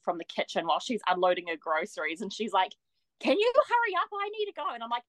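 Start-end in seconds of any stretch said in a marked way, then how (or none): 3.2-4.7 high, impatient hurry-up call. Retold or imitated. The friend yelling from the kitchen, a high impatient call. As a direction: call out from another room, hurrying her.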